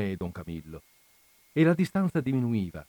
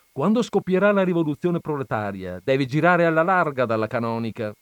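loud, raised, emphatic declaration; priest's defiant warning; 5 semitones higher, 6 dB louder than usual. Acted. Don Camillo warns Peppone away from the rectory, defiant. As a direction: warn him off forcefully.

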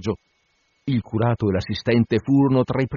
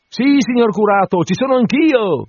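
voce molto più alta e forte, servile; l'assistente risponde al curato, acuto; assistente ossequioso; 12 semitones higher, 5 dB louder than usual. A narrator's reading. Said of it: "rispose l'altro". (assistente servile, voce acuta)

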